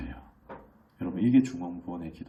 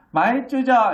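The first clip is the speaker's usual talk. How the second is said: put-on high, loud bossy child voice; a cheeky kid ordering dad around; bold demanding kid; 13 semitones higher, 10 dB louder, slower than usual. Preacher voices his second child demanding money, high and loud. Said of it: "너무 당당하게 요구를 하는 거예요".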